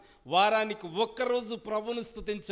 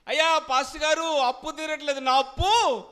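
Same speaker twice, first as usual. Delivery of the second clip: high, urgent acted shouting; loud demanding plea; 6 semitones higher, 8 dB louder than usual. Debtor begging pastor for prayer, loud high plea. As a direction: clamour for help at high pitch. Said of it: "గట్టిగా ప్రార్థన చేయించుకుంటాం".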